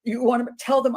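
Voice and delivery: ordinary talk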